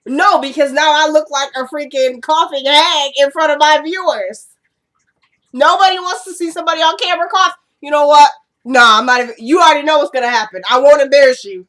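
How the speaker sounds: exasperated, high and loud; annoyed scolding. Real, not acted. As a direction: scold someone across the room, indignant. Scolding someone off camera over cough medicine.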